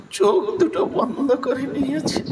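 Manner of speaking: high voice breaking with tears; choked up. Real, not acted. Narrator overcome by Bidurani's sorrow, tearful breaking voice. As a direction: squeeze the words out through a sob.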